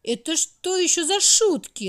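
high, loud cross outburst; angry. A narrator's reading. Old Year angered by the stopped clock, loud and high. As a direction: snap at the joke, annoyed. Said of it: "рассердился Год".

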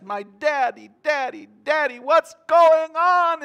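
high voice, rising to a drawn-out wail; pleading little child. Acted. Little child banging on daddy's chest, desperate pleading. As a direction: beg daddy for an answer, building in intensity. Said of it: "a little child climbing up on their daddy's lap and banging".

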